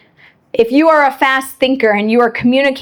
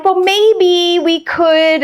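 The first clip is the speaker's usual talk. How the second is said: hesitant hedging; 6 semitones higher, much slower than usual. Retold or imitated. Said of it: "And I'm like".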